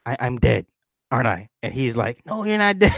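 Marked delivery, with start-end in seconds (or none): 0.0-1.5 imitated matter-of-fact question, trailing down; deadpan. Revived daughter confronts her dad, deadpan. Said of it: "she deadpan asked him". 2.3-2.9 high-pitched mock dad denial; false reassurance. Dad denies his daughter is dead, high-pitched reassurance.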